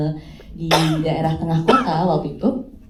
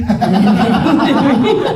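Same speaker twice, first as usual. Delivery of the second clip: loud, high laugh; burst of laughter; 6 semitones higher, 9 dB louder than usual. Real, not acted. Guest joking about a bundled deal, laughing loudly.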